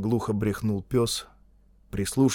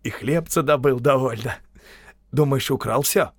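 animated, boastful vagrant; louder, livelier character voice; Konev, cocky rogue; 3 semitones higher, 3 dB louder than usual. A narrator's reading. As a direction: tell your escapade with swagger.

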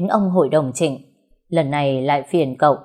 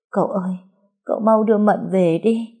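tearful murmur; 4 semitones higher than usual. Acted. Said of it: "quẹt nước mắt mà lẩm bẩm".

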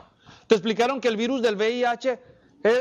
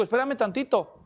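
parent questioning; 4 semitones higher than usual. Retold or imitated. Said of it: "y le digo".

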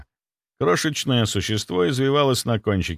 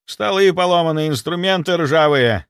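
loud, high report called across; calling out; 7 semitones higher, 5 dB louder than usual. A narrator's reading. Inquisitor reporting junk from the back nook, calling out. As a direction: call the findings over to colleagues. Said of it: "крикнул второй инквизитор из-за кутка".

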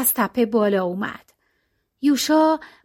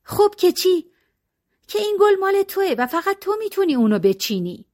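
higher, lightly voiced tearful protest; girl protesting; 8 semitones higher than usual. Lalita to Yusha, tearful higher protest. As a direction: push back through tears.

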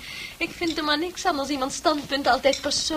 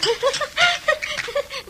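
high delighted giggle; 13 semitones higher than usual. Acted. Pleased with her saying.

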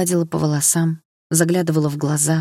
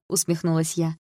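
usual speaking voice

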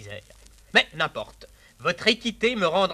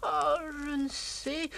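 high, slow, softer sigh of a reply; mock-coy countess giving in; 10 semitones higher, 4 dB quieter, much slower than usual. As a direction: drawl it like a yielding lady.

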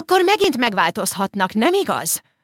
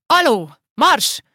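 higher, much louder, slow order; booming slow scold; shouted command; 7 semitones higher, 5 dB louder, slower than usual. A narrator's reading. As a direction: drive him off like a dog. Old man chasing Feri away.

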